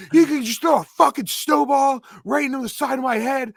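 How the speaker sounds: high, loud, outraged shouting; angry yell. Retold or imitated. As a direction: bellow it like he's been wronged. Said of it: "He's like".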